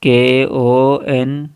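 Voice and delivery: letters read out one by one, slowly; spelling while typing. Shown as a demonstration. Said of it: "जैसे मैं लिखता हूँ".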